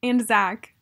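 ordinary talk, nothing marked

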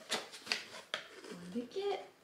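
slow unvoiced whisper; hushed whisper. Real, not acted. Mom whispers to her pug about the box, slow. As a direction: breathe it secretly to the pug.

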